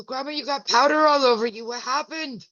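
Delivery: mock alarm at the powder; quoting herself. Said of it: "I go".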